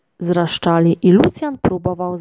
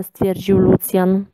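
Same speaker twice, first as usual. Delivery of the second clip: usual speaking voice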